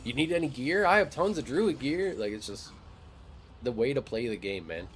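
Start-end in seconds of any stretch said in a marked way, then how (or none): 0.1-2.1 high, enthusiastic welcome; acted keen helpfulness; friendly eager player. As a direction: offer help with pitched-up eagerness. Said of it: "we're just like".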